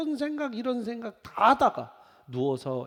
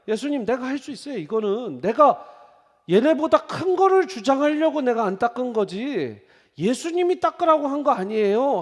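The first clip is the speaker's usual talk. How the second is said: proud insistence; 8 semitones higher, 10 dB louder than usual. Retold or imitated. Voicing proud Peter.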